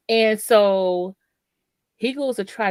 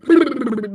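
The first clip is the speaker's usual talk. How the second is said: fluttering lip trill for blowing air; loud brrr of air going past; imitated rushing air; 4 semitones higher, 6 dB louder than usual. Storyteller imitates cold AC air blowing by, fluttering noise. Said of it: "Air is, like".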